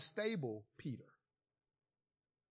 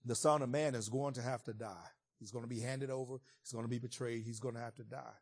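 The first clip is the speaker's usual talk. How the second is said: lower, softer, grave prediction; solemn foretelling; 6 semitones lower, 5 dB quieter than usual. Retold or imitated. Jesus telling his disciples he must die, lower and softer. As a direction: let it sink like grim news.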